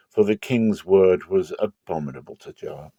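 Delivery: normal talking voice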